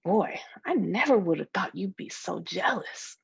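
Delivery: ordinary delivery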